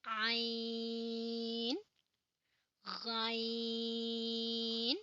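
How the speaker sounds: आइन voiced from mid-throat; throaty Arabic आइन; ain from the throat. Shown as a demonstration. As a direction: press आइन from the middle of the throat. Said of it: "गले के दरमियान से".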